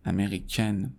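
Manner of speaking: French feminine امریکن with full final n; non-nasal ending. Shown as a demonstration. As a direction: end امریکن softly on "-ken".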